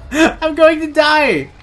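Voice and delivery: high, slow dramatic wail; wailing panic. Real, not acted. Gamer about to be killed, high slow wail. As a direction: stretch it out in despair. Cornered by the creatures.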